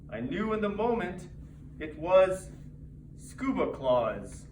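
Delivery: ordinary delivery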